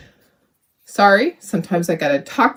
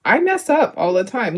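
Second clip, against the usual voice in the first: normal talking voice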